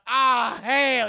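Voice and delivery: high, loud drawn-out wail; mock-horrified drawling outcry; dismayed holler. Kid realizing he's in trouble, dismayed holler.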